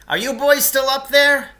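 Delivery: high, loud call from down in a pit; Lance shouting up from the pit. Acted. Trapped man calling up to the boys, loud and high. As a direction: yell up to the boys above.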